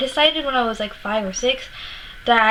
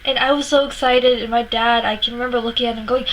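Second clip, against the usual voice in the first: ordinary talk, nothing marked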